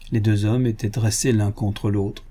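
plain reading voice

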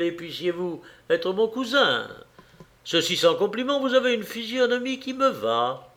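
butter up your drinking companion; flattering Parisian journalist. A narrator's reading. Parisian flattering the man across the table, ingratiating.

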